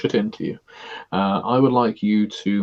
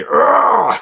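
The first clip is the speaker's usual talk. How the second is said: loud, high straining "argh"; flexing grunt; 11 semitones higher, 7 dB louder than usual. Pretend. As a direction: bark a hammy grunt of flexing abs. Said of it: "flex your stomach muscles and say".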